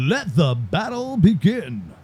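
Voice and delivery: slow announcer slogan read; stretched-out commercial tagline. Retold or imitated. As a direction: deliver it like a TV ad. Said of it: "very commercial quality right there".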